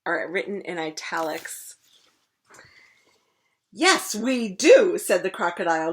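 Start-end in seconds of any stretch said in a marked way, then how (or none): 3.7-5.0 grinning. Acted.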